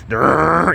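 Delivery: rolled "durr" of gears turning; whirring engine imitation. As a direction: imitate a machine motor revving up. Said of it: "the engine whirring".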